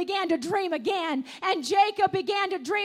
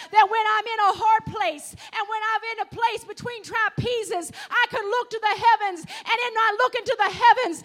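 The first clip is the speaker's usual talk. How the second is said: louder and louder, climbing into a shouted proclamation; full-force preaching; 6 dB louder than usual. Acted. Preacher to the congregation, at full force. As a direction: preach it to the rafters, building.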